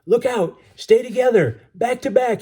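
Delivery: high, loud urgent commands; yelled warning. A narrator's reading. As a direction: yell orders across the water. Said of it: "he yelled".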